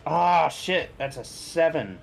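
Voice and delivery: ordinary talk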